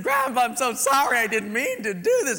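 put-on high, loud tearful boy; pleading confession. Boy confesses to grandpa, high tearful plea. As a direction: beg forgiveness on your knees.

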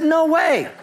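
high-pitched comic refusal; emphatic refusal. Retold or imitated. Freshman refusing the hospital call, comic high refusal.

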